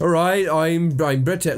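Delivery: bad Cockney British accent, slow; American faking Cockney British badly.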